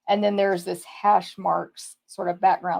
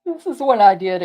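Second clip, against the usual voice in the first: talking through a yawn; words stretched by a yawn; 7 dB louder than usual. Real, not acted. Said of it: "I just yawned".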